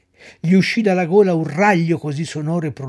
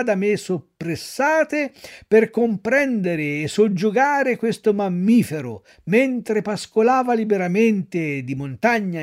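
pompous ringmaster; 5 semitones higher, 4 dB louder than usual. Acted. Circus director presents the donkey to the crowd, pompous. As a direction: declaim like a showman selling his act.

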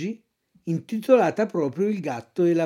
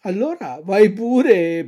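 high, sly fox voice taunting the puppet; 5 semitones higher, 6 dB louder than usual. Acted. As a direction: tease him like a cunning fox. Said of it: "disse la volpe".

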